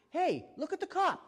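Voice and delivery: loud, high sudden call to distract; mock distraction. Shown as a demonstration. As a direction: point away suddenly. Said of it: "If you have to".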